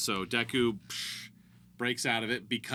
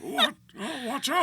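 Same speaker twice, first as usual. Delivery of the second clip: put-on very old man; 4 dB louder than usual. Shown as a demonstration. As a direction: croak it like a tiny old man. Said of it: "I sound like this".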